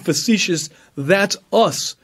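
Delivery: ordinary talk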